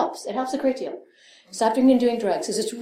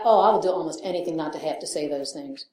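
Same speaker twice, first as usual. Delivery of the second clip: ordinary delivery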